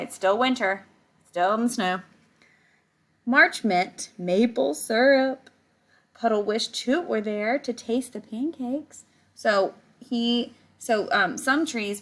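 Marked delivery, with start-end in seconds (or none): 3.2-9.0 reading a picture book aloud to the class; story reading. Acted. Teacher reading the book to her class, storytelling voice. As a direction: tell it like a storybook for kids.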